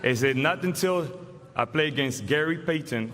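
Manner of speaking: normal talking voice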